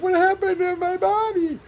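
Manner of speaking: high alarmed mock squeal; waking up after sleeping a week, shocked. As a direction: gasp it out in disbelief. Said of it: "you're like".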